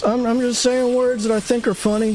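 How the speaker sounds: put-on high, thin old-timer voice; old person voice. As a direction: keep up the old geezer bit. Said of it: "why am I doing an old person voice".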